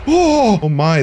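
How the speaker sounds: sharp, high startled cry; sudden loud jolt of fright, pitch leaping. Real, not acted. Player jumps at a raccoon in the game, startled.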